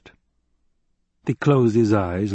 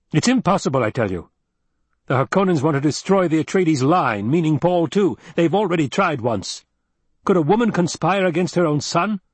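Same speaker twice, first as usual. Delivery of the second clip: higher-pitched, insistent argument; heated denial; 7 semitones higher, 4 dB louder than usual. A narrator's reading. Duke refusing to believe woman would betray son, heated. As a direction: build a hot, urgent case against the accusation.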